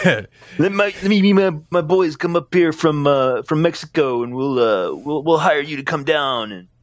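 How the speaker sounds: high fake accent slipping into surfer dude; drifting into surfer. Joking bit drifting from Mexican accent to surfer, high. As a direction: let the accent slide toward a surfer. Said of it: "He's starting to sound like a surfer".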